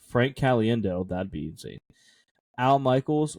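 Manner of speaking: ordinary talk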